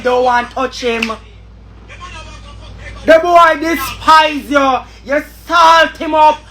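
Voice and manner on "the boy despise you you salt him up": angry shout. Real, not acted. Angry rant at Emily.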